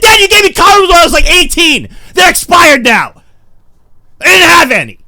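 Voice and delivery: loud, high protest, slowing to hammer the point; exasperated yelling. Retold or imitated. Son arguing back at his dad, exasperated yelling. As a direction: shout back in self-defence.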